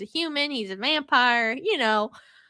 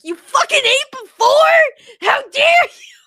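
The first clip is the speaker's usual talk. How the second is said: much higher and louder outraged yell; mock furious shout; 13 semitones higher, 11 dB louder than usual. Rosalie yelling at Bella for eating before dinner, mock rage. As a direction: scream it like a betrayed diva.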